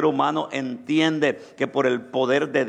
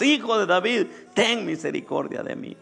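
higher plea, easing off at the end; high insistent cry, falling away; crying out more insistently; 7 semitones higher than usual. Acted. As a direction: call out harder over those hushing you. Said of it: "él clamaba mucho más".

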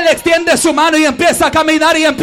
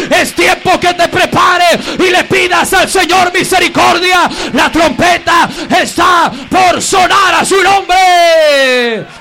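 fervent warning; 2 semitones higher, 3 dB louder than usual. Real, not acted. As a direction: urge them with full fire. (advertencia ferviente y lenta)

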